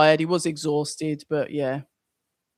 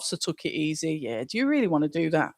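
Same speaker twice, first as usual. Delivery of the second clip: usual speaking voice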